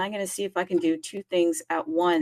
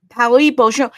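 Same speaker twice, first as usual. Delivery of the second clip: much louder and higher, hailing Paris; calling out greeting; 4 semitones higher, 12 dB louder than usual. Real, not acted. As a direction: belt out bonjour to Paris.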